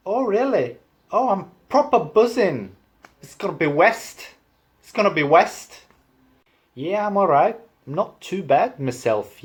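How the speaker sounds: high, swooping Liverpool lilt; sing-song Liverpudlian accent; Scouse accent. Shown as a demonstration. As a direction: do an animated Scouser.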